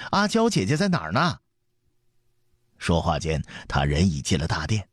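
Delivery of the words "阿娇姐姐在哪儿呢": much higher, insistent second call; young prince calling. A narrator's reading. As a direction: repeat the boy's call, pitched right up. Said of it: "门外传来刘彻的声音". (小太子高声再唤)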